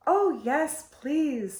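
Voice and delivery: put-on eager little girl, high. A narrator's reading. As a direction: ask sweetly like a small child. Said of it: "said Eulalie".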